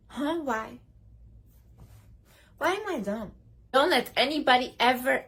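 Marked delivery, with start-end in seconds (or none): 0.1-3.3 innocent challenge. Shown as a demonstration. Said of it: "Say like".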